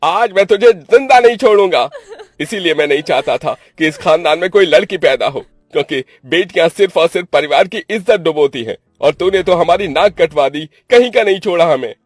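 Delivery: high, loud enraged brother; explosive angry tirade; furious shouting. Acted. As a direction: rage at your sister at full volume. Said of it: "Niranjan को अपने गुस्से में".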